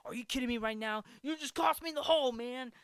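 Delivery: high, rushed mock outrage; indignant complaint. Golfer blaming the heckler for his lost hole, indignant.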